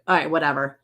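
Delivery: ordinary talk, nothing marked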